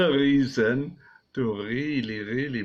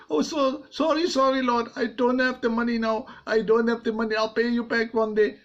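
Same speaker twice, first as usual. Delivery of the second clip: high, fast, pleading servant; begging servant; 10 semitones higher, faster than usual. Acted. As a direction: beg for time, flustered. Servant begs the king.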